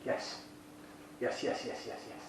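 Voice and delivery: hushed, unvoiced yes yes yes while sniffing; soft whispered approval over the glass; whispered yeses. Real, not acted. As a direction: breathe the yeses out under your breath. Savouring the aroma while sniffing.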